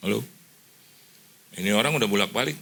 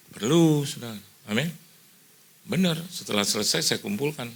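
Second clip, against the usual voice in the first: ordinary delivery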